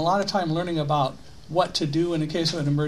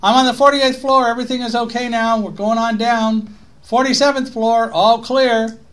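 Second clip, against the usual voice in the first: loud, high call up the stairwell; re-enacted shout, sustained and raised; imitated shouting; 7 semitones higher, 8 dB louder than usual. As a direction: call out the all-clear to people floors away. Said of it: "he started shouting".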